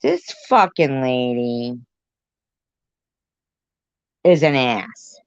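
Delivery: ordinary talk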